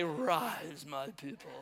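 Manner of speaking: low, drawn-out mock majesty; god-king command. Preacher fantasizing he is sun god, mock-regal. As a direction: intone it like a ruler over worshippers. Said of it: "I wanna say".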